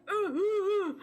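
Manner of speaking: high strained groans; pained groans. Retold or imitated. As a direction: groan over and over. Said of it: "the gummy experience was like".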